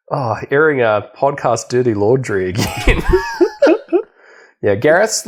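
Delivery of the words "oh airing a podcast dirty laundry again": usual speaking voice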